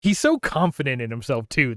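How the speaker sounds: usual speaking voice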